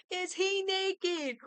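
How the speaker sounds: a different slow, high voice asking again; lightly voiced incredulous echo; mock character voice. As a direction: repeat it as a put-on voice.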